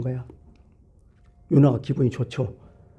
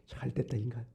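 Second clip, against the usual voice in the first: very soft, lower aside; inner muttering; 4 semitones lower, 17 dB quieter than usual. Acted. Jonah gloating to himself over the doomed people, muttered inwardly. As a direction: drop to a private gloating mumble. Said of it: "속으로".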